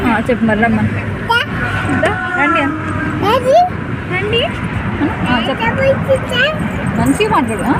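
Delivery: high, loud sing-song coaxing. Real, not acted. Coaxing child to greet viewers. (పిల్లకు నేర్పిస్తూ ముద్దుగా చెప్పడం)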